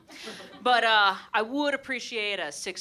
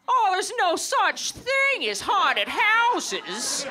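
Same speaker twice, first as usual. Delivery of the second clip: high, slow Jimmy Stewart drawl with laughter; sexist Jimmy Stewart; 11 semitones higher, 3 dB louder, slower than usual. Acted. Dismissive husband to scared wife, high Jimmy Stewart voice. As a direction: ham up a condescending old-movie husband. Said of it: "the husband sounds like sexist Jimmy Stewart".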